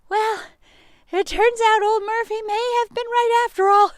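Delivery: a funny high voice commenting on the story; character aside. Acted. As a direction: exclaim it like a fussy critter. Character voice, much higher.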